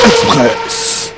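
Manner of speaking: high, loud jingle-style Express; jingle announcer. Pretend. Host calling out the segment name like a jingle.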